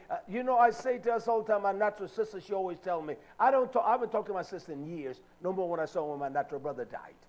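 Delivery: ordinary talk, nothing marked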